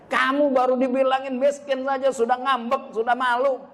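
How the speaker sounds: lightly voiced mom mocking a sulky child; scolding mother. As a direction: mimic a mother scolding her kid.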